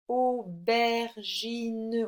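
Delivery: very slow, drawn-out syllables. Shown as a demonstration. Teacher locates the sound for a pupil, syllable by syllable. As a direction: sound out each syllable at length. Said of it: "on entend bien le S sur la seconde".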